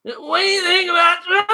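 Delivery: high shouted host question; mock host shout. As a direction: yell the question like a hammy host. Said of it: "that's what T V shows are now".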